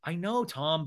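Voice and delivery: normal talking voice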